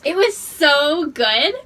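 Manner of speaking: loud, high, slow, excited. Real, not acted. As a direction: draw out each word in delight. Excited retelling of reaction.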